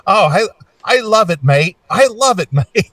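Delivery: high, cheerful imitation of Phil; British mate voice. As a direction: chirp it like an English rocker. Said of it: "And he goes".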